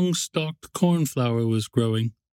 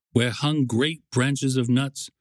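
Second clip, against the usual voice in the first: ordinary delivery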